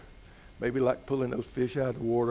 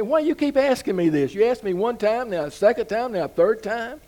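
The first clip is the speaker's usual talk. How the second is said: higher and louder, fed-up complaint; exasperated Peter; 7 semitones higher, 6 dB louder than usual. Retold or imitated. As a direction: push back at being asked yet again. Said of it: "Peter gets a little bit agitated".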